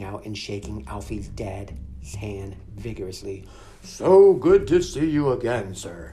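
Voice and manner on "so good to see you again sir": mystical druid voice. Acted. Druid greets visiting father.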